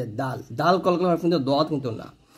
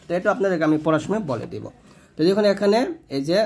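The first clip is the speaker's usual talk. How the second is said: ordinary delivery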